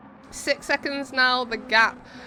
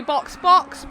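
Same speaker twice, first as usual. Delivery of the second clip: urgent radio call; 3 semitones higher, 6 dB louder than usual. Acted. Team radio call to pit.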